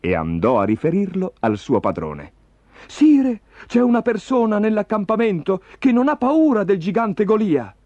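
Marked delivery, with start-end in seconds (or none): 2.9-7.7 high-pitched, excited report to a king; raised, eager herald voice; urgent messenger. A narrator's reading. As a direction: call out the news to your master.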